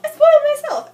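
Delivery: anguished self-blaming outburst; frustrated cry. Real, not acted. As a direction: blurt it out, exasperated at yourself.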